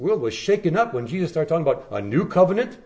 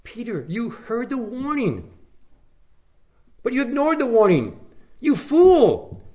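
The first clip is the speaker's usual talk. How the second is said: much higher and louder, building to You fool; exasperated scolding; 11 semitones higher, 4 dB louder than usual. Acted. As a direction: scold Peter in frustration. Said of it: "we think".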